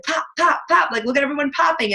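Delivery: high, playful pop pop chant; mock cheering. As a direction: cheer them on like a hype squad. Team cheering each other on the page.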